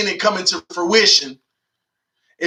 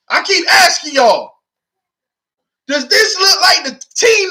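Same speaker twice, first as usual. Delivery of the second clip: fired-up rant; 6 semitones higher than usual. Real, not acted. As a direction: yell it out in protest. Fan ranting about tanking claims.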